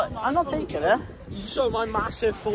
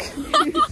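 short laugh. Real, not acted. Joke about paying.

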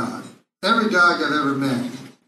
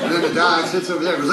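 normal talking voice

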